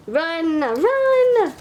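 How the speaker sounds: high, loud run run; mock alarmed run. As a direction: yell it like fleeing. Comic warning to flee.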